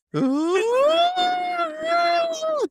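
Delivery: long, very high mock scream; high-pitched crazy wail, held long; imitated blood-curdling scream. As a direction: imitate a crazed movie scream, drawn out. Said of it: "crazy, crazy blood-curdling scream".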